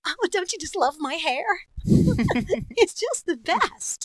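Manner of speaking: high, bubbly doll voice with a giggle; Barbie voice. Acted. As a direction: gush like a vain doll. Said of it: "I was also Barbie".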